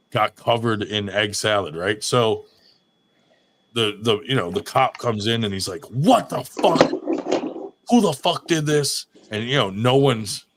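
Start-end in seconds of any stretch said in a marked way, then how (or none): 5.9-9.1 higher mock yell of a furious cop; angry cop yell. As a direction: bark it like the cop who found the mess. Cop finds egg salad everywhere.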